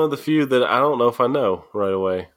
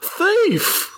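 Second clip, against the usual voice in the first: much higher, sharp accusing shout of a word; accusing cry. Acted. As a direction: fling the accusation at the stranger. Acted anime scene, character B.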